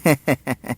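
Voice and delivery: priest's soft little laugh; short chuckle. A narrator's reading. Priest amused by Sirisha's daily gift, short chuckle. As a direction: chuckle lightly to yourself. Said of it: "పూజారి నవ్వుకొని".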